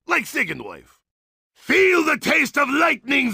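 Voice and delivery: ordinary delivery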